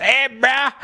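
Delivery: high mock bro greeting; high exaggerated bro caricature. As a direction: call out like a hammed-up bro. Playing host of his bro show.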